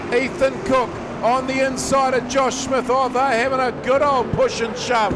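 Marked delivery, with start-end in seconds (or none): 3.1-5.1 motorsport commentator. Real, not acted. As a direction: shout the last lap home. Final lap live call.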